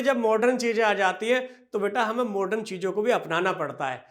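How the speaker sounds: ordinary talk, nothing marked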